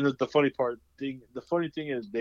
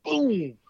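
sound effect; 12 semitones higher, 7 dB louder than usual. Retold or imitated. Cop slams head on wall.

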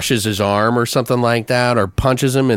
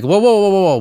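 higher, louder rapid whoas to stop him; alarmed whoa; 8 semitones higher, 4 dB louder, faster than usual. Acted. Cop stopping a panicked kid from jumping out, alarmed and raised. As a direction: rein him in before he bolts.

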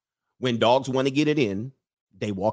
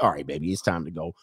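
casual lover leaving; 8 semitones lower than usual. Acted. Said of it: "And he's like".

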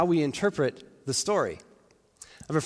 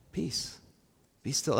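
hushed, soothing, with a pause between; a calm centre amid chaos; peaceful calm; 2 semitones lower, 7 dB quieter, slower than usual. Acted. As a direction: speak softly to settle everything. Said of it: "standing up in the midst of the crazy chaos".

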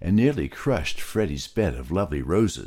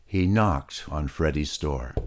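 ordinary delivery

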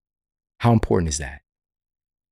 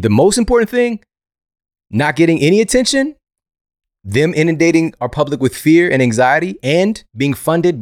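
raised, high and louder; passionate raised voice; 8 semitones higher, 5 dB louder than usual. Real, not acted. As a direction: raise the voice in indignation. Heated rant on media.

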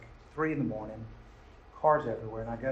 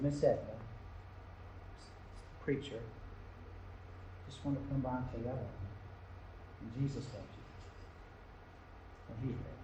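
soft whisper; 8 dB quieter than usual. Retold or imitated. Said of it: "I whispered in her ear".

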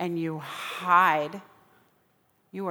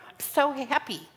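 usual speaking voice

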